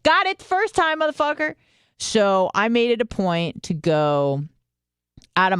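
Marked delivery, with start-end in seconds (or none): 0.0-1.5 high, squeaky mock retort; mock outburst. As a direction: snap back in a shrill comic squeal. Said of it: "I'm like".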